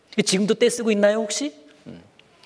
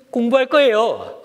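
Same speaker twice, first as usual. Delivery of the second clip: put-on high, louder child's announcement; eager pretend-studious child; 9 semitones higher, 5 dB louder than usual. Child to parents about to give an errand, eager pretence. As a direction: announce it loudly to fend off a chore.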